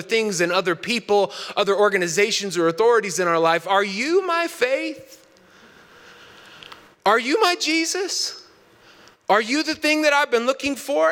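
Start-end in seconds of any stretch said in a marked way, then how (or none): 3.7-11.1 high-pitched, wondering questions; searching, pleading. Acted. Seeker asking authorities for faith, high searching voice. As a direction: ask like a lost chick, voice lifted high.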